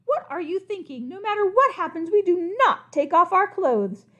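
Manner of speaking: high, outraged scolding; shocked scolding. Acted. Scandalized king scolds the crocodile, high pitched.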